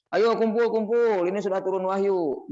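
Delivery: rallying call, voice lifted; calling people together. Acted. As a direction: call everyone over to gather round. Acting out calling the companions together.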